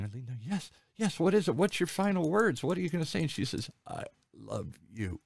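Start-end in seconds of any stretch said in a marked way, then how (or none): 0.4-3.2 high, urgent coaxing; eager urging. Retold or imitated. Nephew leaning over his dying aunt, high eager urging. 3.9-5.2 slow, much softer, barely there; faint last words. Retold or imitated. Dying aunt saying her final words to her nephew, slow and faint.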